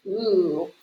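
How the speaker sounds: normal talking voice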